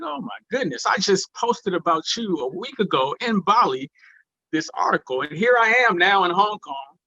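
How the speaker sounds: high-pitched delighted disbelief; amazed. Retold or imitated. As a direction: marvel at an astounding coincidence. Amazed by the astounding coincidence.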